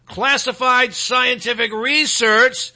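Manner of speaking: broadcast voice. Shown as a demonstration. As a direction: draw out each term like a broadcaster. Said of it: "I'll read in my broadcast voice".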